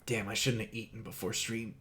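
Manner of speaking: muttered under the breath, soft; muttered quietly. Real, not acted. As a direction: mumble it to yourself. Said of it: "Shouldn't, shouldn't eaten what?".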